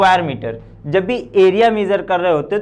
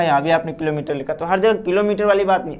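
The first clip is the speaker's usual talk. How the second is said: ordinary talk, nothing marked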